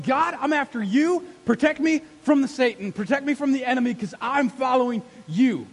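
loud, high, urgent pleading; praying like a street fight; fighting prayer. Acted. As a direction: plead with God like in a battle. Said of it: "an all-out street fight".

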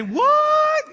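high, loud shocked yelp; shocked what. Pretend. Ezekiel hearing he's sent to the Israelites, shocked high yelp. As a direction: jump up in disbelief.

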